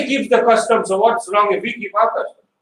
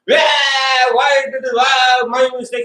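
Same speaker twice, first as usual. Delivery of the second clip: mock wailing; 5 semitones higher than usual. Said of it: "He's yelling".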